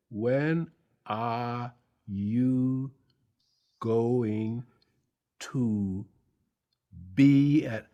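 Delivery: very slow, word-by-word reading; labored, over-careful sounding out of each word; slow halting reading. Retold or imitated. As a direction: read like a struggling schoolboy, pausing between words. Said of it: "I am very slow".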